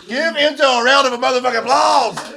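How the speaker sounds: high, loud hype call; loud shout. Real, not acted. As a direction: shout it to the crowd. Calling for applause.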